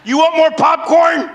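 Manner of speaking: high, loud movie-theater talk. Retold or imitated. Man chatting loudly in the movie theater after previews. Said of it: "I'm the guy in the movie theater".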